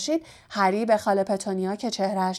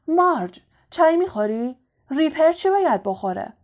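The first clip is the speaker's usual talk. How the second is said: higher-pitched, a little louder questions; lightly voiced host; 6 semitones higher, 4 dB louder than usual. A narrator's reading. Uncle offering tea to his visiting sister, lightly voiced host. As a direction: call out an offer to a guest.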